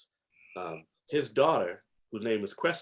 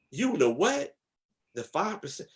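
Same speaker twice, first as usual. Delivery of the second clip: mother's warning; 9 semitones higher than usual. Retold or imitated. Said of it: "My mother said".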